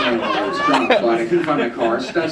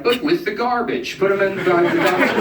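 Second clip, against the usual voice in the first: club manager; 8 semitones higher than usual. Retold or imitated. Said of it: "And they're like".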